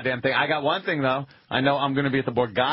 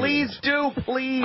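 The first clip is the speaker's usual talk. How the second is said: mock eager; 11 semitones higher, much slower than usual. Sarcastic high reply to plug.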